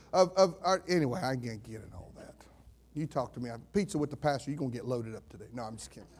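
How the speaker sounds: ordinary delivery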